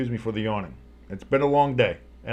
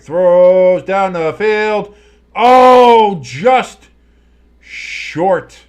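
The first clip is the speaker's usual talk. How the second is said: higher and louder, then sinking on the miss; 7 semitones higher, 12 dB louder, much slower than usual. Real, not acted. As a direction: build up on the throw, deflate at short. Deep pass call, falls short.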